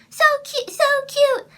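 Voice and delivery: very high, loud mock squeal; exaggerated cooing at top pitch; gushing commenter imitation. Vlogger imitating commenters on her voice, mock gushing. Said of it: "I get so many comments".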